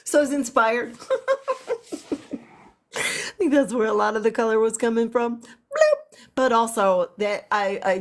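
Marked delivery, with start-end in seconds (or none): 1.0-2.4 high giggle. Real, not acted. Laughing after kitty meows. 5.4-6.2 squeaky bloop. Pretend. Playful sound effect.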